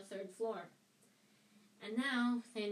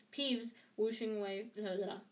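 usual speaking voice

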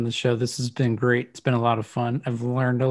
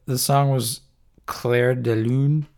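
ordinary delivery

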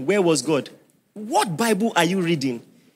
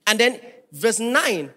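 usual speaking voice

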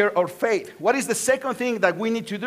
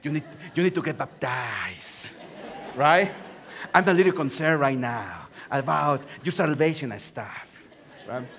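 lightly voiced comic wrestler-priest, lower; a playful hint of Nacho Libre; 6 semitones lower than usual. Pastor jokes to congregation about baptism, light Nacho Libre voice. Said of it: "I sound like Nacho Libre".

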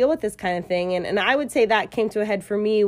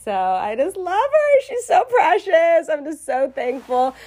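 crying as she praises her friend; choked up while praising her friend; tearful; 9 semitones higher, 5 dB louder than usual. Real, not acted. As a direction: voice breaking into real tears, higher. Emotional about her friend's gift.

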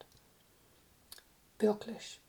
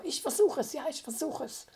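higher, starting loud and excited; eager agreement; 5 semitones higher, 5 dB louder than usual. A narrator's reading. Isa agreeing to try her voice on the car, eager agreement. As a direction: jump in eagerly, pitched up.

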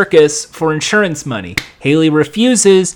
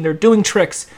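ordinary talk, nothing marked

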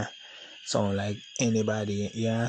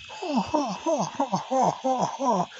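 mock laugh; 13 semitones higher than usual. Laugh inside the impression.

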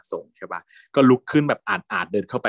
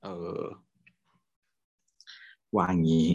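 normal talking voice

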